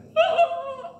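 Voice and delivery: a high squeaky burst of laughter; high-pitched giggle. Real, not acted. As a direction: laugh high at your own story. Laughing at the memory.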